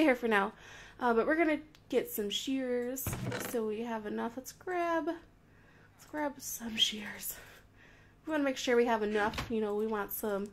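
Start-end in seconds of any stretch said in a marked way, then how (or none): 5.1-7.3 slow secretive whisper; sneaky whisper. Acted. Sneaky whisper while playing.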